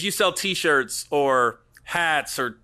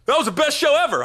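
gushing fan; 8 semitones higher, 4 dB louder, faster than usual. Retold or imitated. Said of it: "they'll be like".

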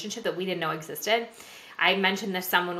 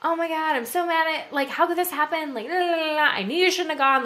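higher, frantic panicked rant; mock freak-out; 9 semitones higher than usual. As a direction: ham up the old freaking-out self. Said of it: "the old me would have freaked the fuck out".

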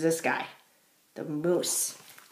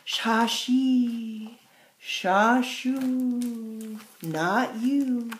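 drawn-out whooshing syllables; lightly voiced wind; 5 semitones higher, 5 dB louder, much slower than usual. A narrator's reading.

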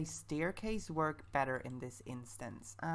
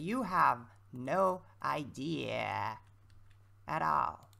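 dramatic slow; 3 semitones lower, much slower than usual. Acted. Teasing viewers about cracking voice.

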